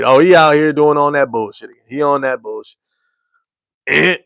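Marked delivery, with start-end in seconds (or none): none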